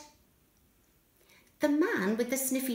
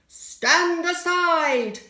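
bossy stand aside; 5 semitones higher, 6 dB louder, much slower than usual. Acted. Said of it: "said the man with the sniffy dog".

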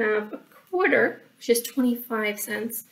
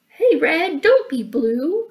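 friendly call, a little raised; 5 semitones higher, 5 dB louder, slower than usual. Acted. Billy Bob cheering up Red, calling out. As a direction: call over to cheer someone up. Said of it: "called out Billy Bob".

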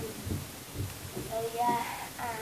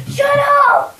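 shrill, loud scream of frustration; 13 semitones higher, 8 dB louder than usual. Retold or imitated. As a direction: scream it, fed up. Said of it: "So then I just screamed".

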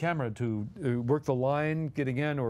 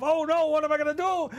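much higher, loud mock-panic; panicked wail; 12 semitones higher, 5 dB louder than usual. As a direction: wail in comic despair. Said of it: "I cried".

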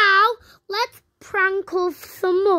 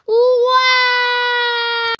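mock wail; 3 semitones higher than usual. Caller wails going to jail.

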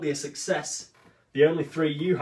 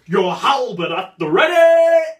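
barked, much louder and higher order; military command; 8 semitones higher, 11 dB louder than usual. Acted. Cyrano shouts a soldier's command about the nose. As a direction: bellow it like a drill sergeant.

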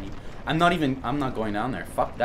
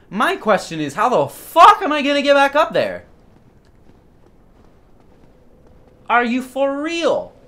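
high, loud disbelieving outburst; exasperated shout; 13 semitones higher, 9 dB louder than usual. Real, not acted. Gamer stuck below a ledge, exasperated. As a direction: demand an answer in loud disbelief. Cannot find way back up.

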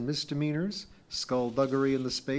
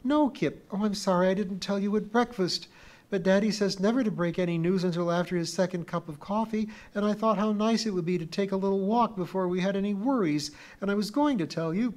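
put-on high, louder girl insisting; a young girl urging Kip to listen; pleading little girl; 9 semitones higher, 5 dB louder than usual. A narrator's reading. Pee-wee explains the secret to Kip, high and urgent.

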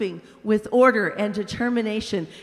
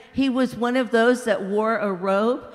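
ordinary delivery, nothing marked

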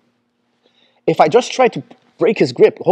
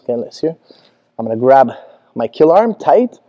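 normal talking voice

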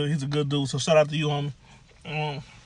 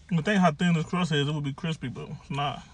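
chewing while talking; talking between bites; mouth full of pizza; 7 dB quieter than usual. Real, not acted. Seen 0: Bites pizza at 2.9, then chews while talking.

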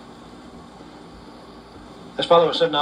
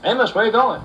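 hailing a neighbour passing the porch, pitched higher; calling out; 7 semitones higher than usual. Acted. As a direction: call out to him down the road. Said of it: "he called out".

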